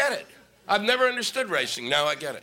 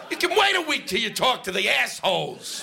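ordinary talk

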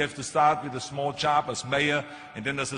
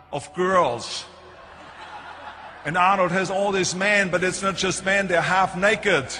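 mock alarmed mother; 5 semitones higher, 5 dB louder than usual. Mother alarmed, telling the doctor.

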